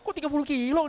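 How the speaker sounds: high, loud disbelieving query; incredulous question. Retold or imitated. Coworker questioning the inflated mileage claim, high and incredulous. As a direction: question it in high-pitched amazement. Acted challenge to colleague's false claim.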